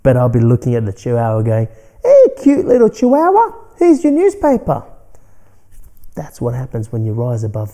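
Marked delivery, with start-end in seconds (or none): 2.0-4.8 put-on much higher, louder baby-talk to a tiny dog; cooing at a dog. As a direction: coo sweetly at the little chihuahua. Greeting small dog while delivering paper.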